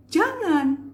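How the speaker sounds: loud, high, drawn-out prohibition; angry intonation. Shown as a demonstration. Coach answering own example question, angry high exclamation. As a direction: cry out a firm angry refusal. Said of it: "ini intonasi".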